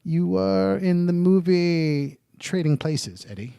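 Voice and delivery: slow, drawn-out interviewer voice, each word savoured; mock talk-show host, unhurried and grand; James Lipton impression. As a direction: ham up the pompous TV interviewer, slowly. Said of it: "that's how he talks".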